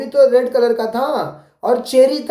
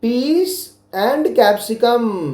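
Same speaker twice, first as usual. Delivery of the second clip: slow model answer; much slower than usual. Shown as a demonstration. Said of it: "पहले तो हाँ बोलना है".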